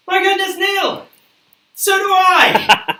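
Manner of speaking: high, loud gasping surprise; hammed-up shock; mock astonishment.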